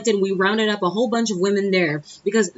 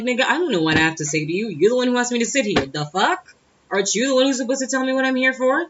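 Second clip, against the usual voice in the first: higher, faster pushback; indignant retort; 6 semitones higher than usual. Retold or imitated. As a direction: throw the question back at him, indignant. Acted retort to interrogator.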